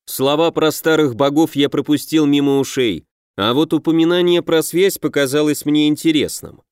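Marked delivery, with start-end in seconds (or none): none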